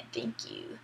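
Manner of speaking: low, quiet mock-smug chuckle and thanks; chuckle, then drop low and quiet. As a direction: imitate a self-satisfied villain under the breath. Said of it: "And being all smug".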